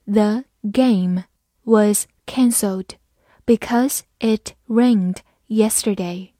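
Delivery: slow, word by word with gaps; slow reading. Shown as a demonstration. Teacher models the sentence for learners, slow and separated. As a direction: read it slowly for learners, pausing between words. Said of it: "慢慢来读".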